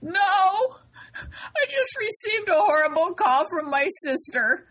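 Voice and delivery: hammed-up tearful wail, not in earnest; mock sobbing. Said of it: "sees her crying hysterically".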